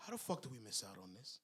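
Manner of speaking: low, muttered gangster imitation; hushed, quick mock grievance; Paulie. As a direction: mutter it fast and very softly, mimicking a mobster. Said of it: "and Paulie says".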